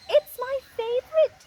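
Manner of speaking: high, loud forced cheer; fake politeness. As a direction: gush it out falsely to please. Boa's polite fake thanks.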